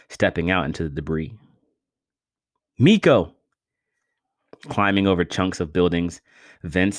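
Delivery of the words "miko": high, loud desperate call. A narrator's reading. Vince searching the wreckage for Miko, calling loudly. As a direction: shout his friend's name across the rubble. Vince calls for fallen friend.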